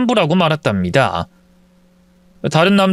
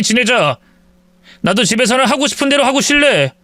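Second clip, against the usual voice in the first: higher, resentful sarcastic grumble; bitter husband; 7 semitones higher than usual. Acted. Husband ending the fight with wife, sarcastic and higher. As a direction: sulk and give in spitefully.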